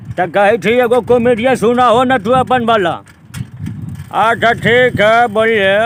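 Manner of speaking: Lalu Yadav impression. Politician answering a caller, high loud and slow. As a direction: drawl it out like a folksy Bihari politician. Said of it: "तो लालू यादव को सुनाऊंगा मैं".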